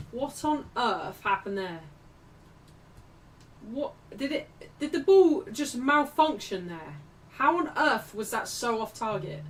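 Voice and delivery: baffled, loud and swooping in pitch; incredulous outburst. Real, not acted. Commentator reacting to a shot wildly off target, incredulous. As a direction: cry out in sheer disbelief at it.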